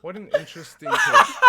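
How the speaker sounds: loud laugh through the words. Real, not acted. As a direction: burst out laughing while speaking. Reacts to clip, laughing loudly.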